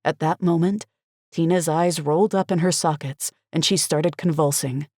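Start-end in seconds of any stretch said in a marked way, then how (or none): none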